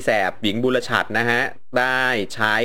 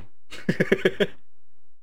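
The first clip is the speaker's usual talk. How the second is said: high, soft wheeze of laughter; breathy laugh; 8 semitones higher, 5 dB quieter than usual. Real, not acted. Laughs after giving hedged answer. (หัวเราะเสียงสูงปนลม)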